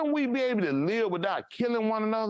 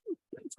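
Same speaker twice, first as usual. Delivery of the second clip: high squeal of a laugh; 12 dB quieter than usual. Real, not acted. Bursts out laughing.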